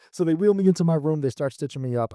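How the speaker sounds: ordinary talk, nothing marked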